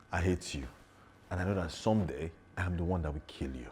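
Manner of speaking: soft, understated vow to kill; subdued threat. Shown as a demonstration. Actor shows a comparison take of the threatening line, soft and subdued. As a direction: say the threat quietly, without pushing. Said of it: "It's different from".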